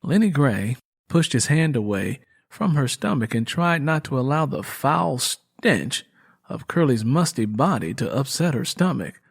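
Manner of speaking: plain reading voice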